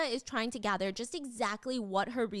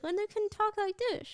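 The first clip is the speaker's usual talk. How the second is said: high ventriloquist voice; ventriloquist talk; 10 semitones higher than usual. Shown as a demonstration. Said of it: "the most skilled phone ventriloquist".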